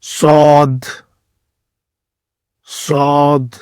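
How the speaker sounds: صاد thick and pressed, a heavy s; heavy Arabic sad. Shown as a demonstration. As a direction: fill the mouth and press out صاد. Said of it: "موٹا کر کے پڑھیں گے".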